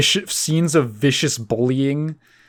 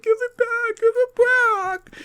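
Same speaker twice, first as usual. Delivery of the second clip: very high falsetto whine, imitated; mock pleading boy; slower than usual. Sherman begging for his dog whistle, mock falsetto plea. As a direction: squeal it as a put-on little boy. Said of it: "and he's like".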